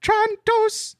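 high, put-on Greek accent for "Trantos". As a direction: say the name in a hammy Greek accent. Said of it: "how does one do a Greek accent?".

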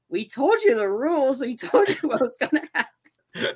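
high-pitched, giddy retold quote; mock Johnny. Johnny telling off the man sent flying, high mock voice. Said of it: "then Johnny was like".

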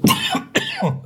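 choking cough after swallowing wrong; sudden harsh coughing fit. Real, not acted. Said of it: "Entschuldigung, hab ich verschluckt". (Hustenanfall nach dem Verschlucken)

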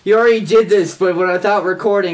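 high, loud playful whine; loud protest. Real, not acted. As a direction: protest loudly, half laughing. Protesting a repeated game.

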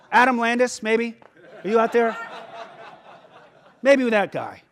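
calling out a name while laughing; laughing tease. Real, not acted. Pastor teasing a congregant, laughing. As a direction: chuckle through the playful callout.

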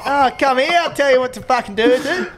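mock Aussie accent. Mocking accent voice.

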